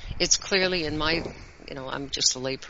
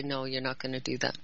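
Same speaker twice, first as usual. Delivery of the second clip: put-on low-key, softened no to a child; quiet firm refusal; 3 semitones lower, 7 dB quieter than usual. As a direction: refuse softly, without raising your voice.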